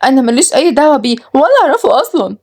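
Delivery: high-pitched, panicky denial; fearful. A narrator's reading. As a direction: squeak out excuses to dodge the blame. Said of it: "روز بخوف".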